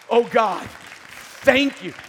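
high, loud cry of thanks; loud, grateful outburst to God; retold relieved cry. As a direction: re-enact relief at full volume. Retold relief at finding son.

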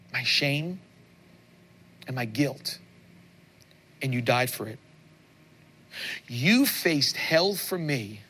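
slow prayer line by line with long pauses; led prayer. Acted. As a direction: lead the congregation through a prayer, phrase by phrase. Leads the sinner's prayer.

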